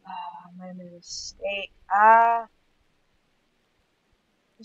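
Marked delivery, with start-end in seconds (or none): 1.9-2.5 loud short startled gasp. Real, not acted. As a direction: gasp at a sudden scare.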